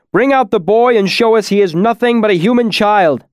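high, loud call to those inside; yelled demand. A narrator's reading. The smith demanding to see the child, yelling. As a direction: shout a challenge at the house. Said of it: "he yelled".

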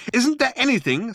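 high-pitched squawk of outrage; squawking fury. A narrator's reading. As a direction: squawk it like a furious bird. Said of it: "squawked Ford in avian fury".

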